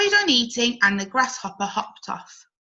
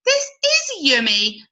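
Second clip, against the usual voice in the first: higher, pleased butterfly; savouring the nectar; delighted butterfly; 6 semitones higher, 4 dB louder than usual. Acted. Cody sips nectar.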